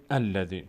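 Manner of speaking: "الذی" opened with a clear "a", as "alladhi"; starting form. Shown as a demonstration. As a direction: begin "الذی" fresh on a fatha.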